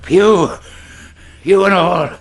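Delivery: loud alarmed recognition; shocked loud outcry. Acted. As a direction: cry out at a dreaded face. Captain recognises blind Pew.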